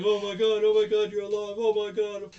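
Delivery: high, very loud retold freak-out; mock terrified yelling. Onizuka scared by the fake zombie, mock terrified. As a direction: shriek out astonished panic, hammed up. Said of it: "scares the shit out of him".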